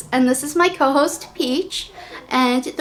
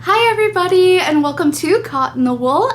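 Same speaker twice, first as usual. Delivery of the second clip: customer service voice; 5 semitones higher, 6 dB louder than usual. Real, not acted. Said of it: "I'm kinda doing my customer service voice".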